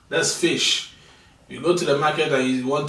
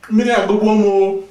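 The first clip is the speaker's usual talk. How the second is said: raised, louder cry of thirst; thirsty complaint; 5 semitones higher, 6 dB louder than usual. Acted. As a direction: exclaim it like parched. Suddenly thirsty after cookie.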